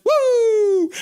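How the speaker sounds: high, loud fan's whoop. Retold or imitated. Voicing a chatter's cheer, a loud high whoop. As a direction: let out a big high woo. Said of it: "And then Andrew's like".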